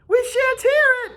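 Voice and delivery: very high, taunting sneer; mocking retort. A narrator's reading. Jack mocking Ralph's threat before the boys, high taunt. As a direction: taunt him, pitched high.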